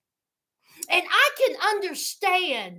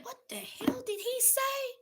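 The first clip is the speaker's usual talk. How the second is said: softer, higher baffled question; hushed aside; 5 semitones higher, 14 dB quieter than usual. Retold or imitated. Baffled viewer asking herself about Blaine, hushed. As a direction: ask it under your breath, puzzled. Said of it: "I said".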